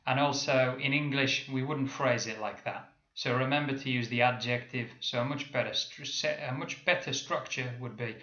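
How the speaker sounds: ordinary delivery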